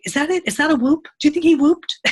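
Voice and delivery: high, loud, panicky questions, half-mocking herself; anxious new mom. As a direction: replay your own first-time panic, high and fast. Retold panic over son's cough.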